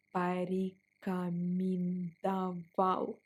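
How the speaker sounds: порекомендовал drawn out syllable by syllable; very slow. Shown as a demonstration. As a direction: spell порекомендовал out slowly. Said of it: "Let's pronounce it slower".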